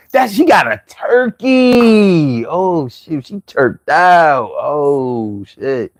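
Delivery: slow Philly drawl on every word; drawn-out Philly accent. Shown as a demonstration. Demonstrating Philly talk for viewers, dragged-out Philly accent. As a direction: stretch the vowels out in Philly style. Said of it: "drag my words out a lot".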